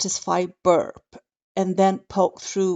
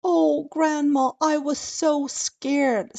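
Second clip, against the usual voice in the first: sobbing; 8 semitones higher, slower than usual. Acted.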